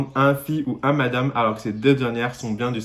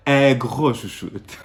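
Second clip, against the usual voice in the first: laughing; much slower than usual. Real, not acted. Joke about chouchoute.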